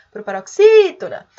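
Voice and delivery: loud hail with "xí" drawn out in "proparoxítona"; ringing call, stressed syllable "xí" held and loud. Shown as a demonstration. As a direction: call "proparoxítona", swelling on the long "xí". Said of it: "se a gente for chamar".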